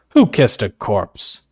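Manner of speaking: a disapproving father's demand; father catching the pair in the garden. A narrator's reading. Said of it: "in obvious disapproval".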